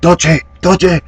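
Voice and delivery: high, eager imitation of her thanks; grateful thanks. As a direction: chirp thanks while bowing. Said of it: "and then she goes".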